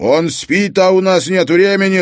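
urgent shout, pitched high; yelling. A narrator's reading. Harry overruling his friend during a shootout, yelling. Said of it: "заорал Харри".

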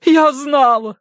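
high, loud, triumphant joy; радостное восклицание. A narrator's reading. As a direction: cry out as a delighted hostess. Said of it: "обрадовалась хозяйка". (радостно, громко и высоко)

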